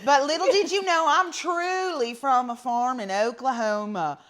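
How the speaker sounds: loud, hammed-up Oklahoma farm twang; theatrical Oklahoma drawl, drawn out at the ends; Oklahoma accent. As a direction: put on a broad country Oklahoma accent. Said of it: "Oklahoma accent".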